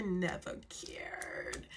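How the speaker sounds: slow breathy whisper aside. Real, not acted. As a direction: whisper it slowly, dismissive.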